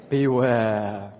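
ominous drawn-out "Beware"; spooky beware voice. Shown as a demonstration. Pastor modelling a warning for the congregation, spooky beware voice. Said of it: "Can you do it in a beware voice?".

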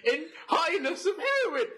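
high-pitched, hammed-up glee; absurd, over-excited joke confession; mock mania. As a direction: blurt it out in a laughing, strained high voice.

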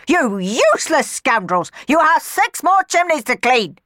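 high, loud furious scolding; angry yelling. Acted. Miss Baggett yelling after the sweeps, loud and furious. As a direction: berate them as they run.

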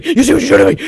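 rising rage. Acted. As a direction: screech it back at her. Repeated, pitch jumps higher.

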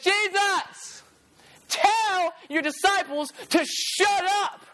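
high, slow, whiny demand; frustrated Pharisees. Acted. Pharisees telling Jesus to silence his disciples, high and whiny. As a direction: complain like an irritated Pharisee. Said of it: "They said".

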